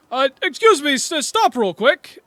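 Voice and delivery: high, loud, slow request; chairman calling out. Acted. Chairman calling back to stop the caravan, high and loud. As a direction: call the caravan to halt. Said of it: "he goes".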